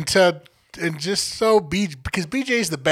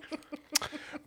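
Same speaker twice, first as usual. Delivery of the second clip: quiet laugh; 10 semitones higher, 19 dB quieter than usual. Real, not acted. Laughing at being blocked.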